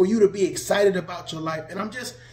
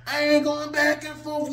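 higher, louder mock of the online claim; mocking quote; 5 semitones higher, 4 dB louder than usual. Mocking people online, higher and louder sneer. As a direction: throw their words back at them. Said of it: "It's like".

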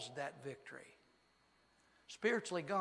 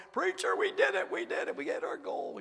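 much higher, near-breathless choked-up voice of a happy man; imitating tearful joy; 13 semitones higher, 8 dB louder than usual. Preacher mimicking Chili Bean telling him the goal was hit, tearful and high. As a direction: mimic a grown man overjoyed to tears. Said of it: "he'd been crying all morning".

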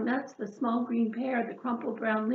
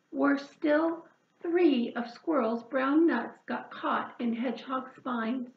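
ordinary delivery

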